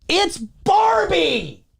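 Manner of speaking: high, slow, drawn-out; exasperated wail. Real, not acted. Fed up with critics, high drawn-out wail. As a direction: stretch it out in disbelief.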